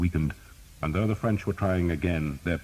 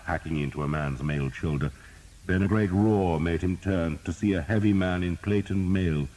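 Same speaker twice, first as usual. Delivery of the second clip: usual speaking voice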